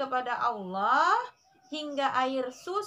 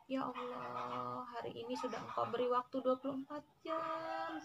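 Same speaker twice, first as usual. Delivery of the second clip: soft tearful prayer; 6 dB quieter than usual. Shown as a demonstration. Mother models crying to God for her children, very softly. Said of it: "Tapi kita melatih menangis".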